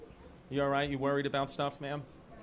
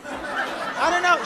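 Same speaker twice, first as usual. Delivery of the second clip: mock careless woman; 12 semitones higher, 5 dB louder, much slower than usual. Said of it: "She's the type".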